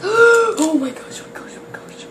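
breathless whisper, fading away; awed whisper. Real, not acted. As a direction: whisper it in awe. Awed over baby cow.

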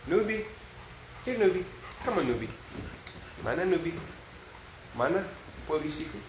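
high sing-song call for Nubi; high coaxing dog call. Real, not acted.

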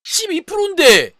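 high, loud amazed cry; thrilled shout. Real, not acted. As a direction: shout in disbelief. Rare gacha drop.

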